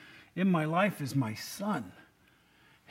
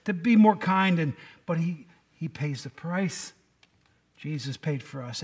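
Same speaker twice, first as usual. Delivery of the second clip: ordinary talk, nothing marked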